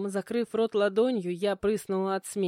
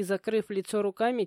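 usual speaking voice

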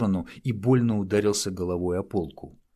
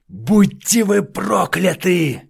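high, loud, slow yell; furious shout; 11 semitones higher, 10 dB louder, slower than usual. A narrator's reading. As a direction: hurl the curse in hatred. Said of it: "в сердцах выкрикнул убийца".

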